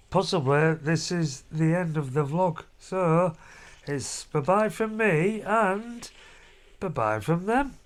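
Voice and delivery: usual speaking voice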